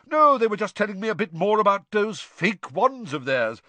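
high, loud, breezy chatter; Bagman. A narrator's reading. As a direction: rattle on in hearty good humour. Bagman voiced, chatting about the twins.

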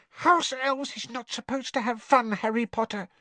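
high, prim, insistent squeak; firm house elf. A narrator's reading. Winky corrects Harry about fun, firm squeak. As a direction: lay down the rule in a tiny voice. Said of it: "said Winky firmly from behind her hands".

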